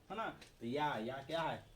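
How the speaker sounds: usual speaking voice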